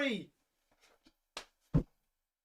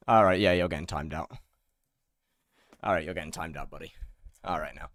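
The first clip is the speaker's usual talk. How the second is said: deep stern voice; 13 semitones lower, 3 dB quieter than usual. Pretend. Timing out a chatter.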